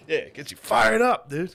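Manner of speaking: high, loud burst of excitement; fired up. Real, not acted. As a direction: let it leap up, pumped and loud. Real excitement about the documentary.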